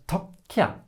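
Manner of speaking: "takke" with a held stop before the k releases; long K. Shown as a demonstration. Teacher introducing the long K, held k in "takke". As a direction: pause inside "takke" at the double k. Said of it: "how do we say a long K".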